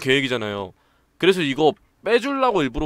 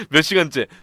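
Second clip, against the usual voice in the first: normal talking voice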